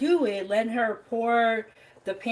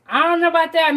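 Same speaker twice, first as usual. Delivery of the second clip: higher and louder, stretching out know; louder half-joking disbelief; doubtful protest; 6 semitones higher, 8 dB louder, much faster than usual. Shown as a demonstration. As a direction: push back on the claim with a loud skeptical I don't know.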